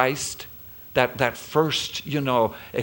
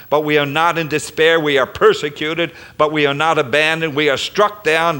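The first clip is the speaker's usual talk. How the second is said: full-force preaching; 3 semitones higher, 6 dB louder than usual. Acted. Pastor proclaims God's mercy to congregation, full preaching force. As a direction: lift it to a preaching peak.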